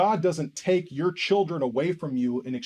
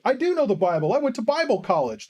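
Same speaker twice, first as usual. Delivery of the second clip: higher-pitched mock indignation; protesting, pitch pushed up; defensive retort; 7 semitones higher, 3 dB louder than usual. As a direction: imitate someone insisting on their credentials. Acted argument, defending himself.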